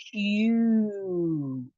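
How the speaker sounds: "Q" held long as kyoo; drawn-out syllable. Shown as a demonstration. Teacher isolating the stressed part of the word, drawn-out syllable. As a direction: stretch the oo of "Q". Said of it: "the emphasis is on the Q".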